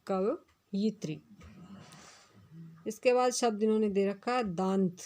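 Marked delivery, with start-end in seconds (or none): 0.1-1.2 कवयित्री spelt out slowly, syllable by syllable; कवयित्री said very slowly and carefully; slow teacher demo. Shown as a demonstration. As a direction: drill कवयित्री slowly for the class. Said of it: "शुद्ध शब्द होता है कवयित्री".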